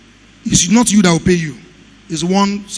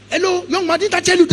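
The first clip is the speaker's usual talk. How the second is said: much higher mock woman's voice, scolding; 12 semitones higher than usual. Vendor back to scold the engineer, high mock voice. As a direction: play her shrill reprimand high up.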